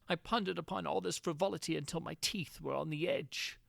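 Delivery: plain reading voice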